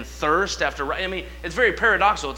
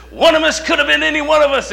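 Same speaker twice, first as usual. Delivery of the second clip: much higher, louder and fast preaching cry; fired-up preacher; 11 semitones higher, 8 dB louder, faster than usual. Retold or imitated. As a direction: preach it out to the whole church. Preacher building to his point.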